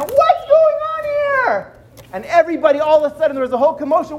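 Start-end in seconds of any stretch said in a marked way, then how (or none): 0.0-1.7 high, slow shocked lady's voice; outraged lady. Acted. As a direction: squeal it like a drenched lady. Coffee-soaked lady exclaims.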